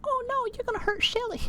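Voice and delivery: high, hammed-up worried cry; mock alarm. High mock alarm as the mushroom threatens Shelly. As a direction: fret in a high voice.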